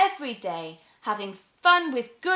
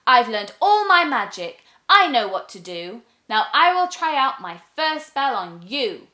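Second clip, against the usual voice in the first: put-on louder, proud young witch; boastful little witch; 3 semitones higher, 8 dB louder than usual. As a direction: brag to the frog. Witch boasts before spell.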